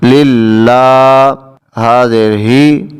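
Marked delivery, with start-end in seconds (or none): 0.0-1.3 للہ with the لا held one alif long; للہ drawn out in recitation; elongated vowel. Shown as a demonstration. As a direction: stretch the long a in للہ slowly. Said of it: "لمبا کر کے پڑھتے ہیں".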